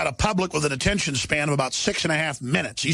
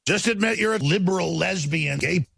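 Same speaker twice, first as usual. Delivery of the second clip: normal talking voice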